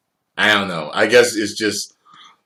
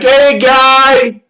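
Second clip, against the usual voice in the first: much higher, louder put-on voice for the greeting; odd greeting; 11 dB louder than usual. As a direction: say it like a stranger's weird hello. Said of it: "It's not like".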